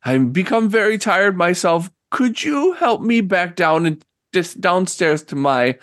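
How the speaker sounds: lightly voiced elderly lady; hesitant, polite plea from a weary hostess; tired old woman. Game master plays old woman.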